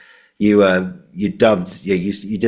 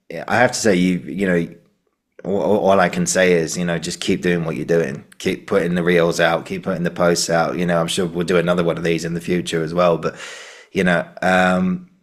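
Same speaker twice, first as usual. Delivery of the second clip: ordinary talk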